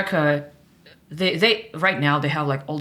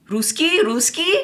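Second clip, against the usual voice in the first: much higher, questioning "Ruski, Ruski?" with a rising tune; quizzical stranger; 11 semitones higher, slower than usual. Retold or imitated. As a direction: mimic a stranger probing whether she speaks Russian. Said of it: "and he's like".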